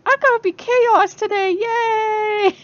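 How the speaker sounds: very high, loud, squealing cheer; a very high, loud, gleeful chaos-god cheer. Retold or imitated. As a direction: cheer it like a giddy trickster. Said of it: "he's like".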